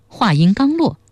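usual speaking voice

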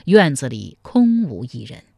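ordinary delivery, nothing marked

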